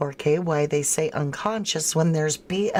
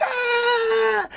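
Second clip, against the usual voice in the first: high shrieking scream of the victim; a sudden high scream in the film; mock horror-movie scream. Viewer retelling a horror film: mock high scream. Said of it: "And then she's like".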